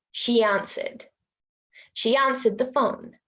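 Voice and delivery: "She answered" as she-yanswered; intrusive y. Shown as a demonstration. Teacher demonstrating intrusive y. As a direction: slip a y in after she. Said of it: "Same with".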